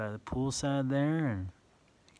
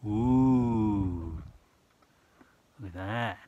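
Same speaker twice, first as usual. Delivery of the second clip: plain reading voice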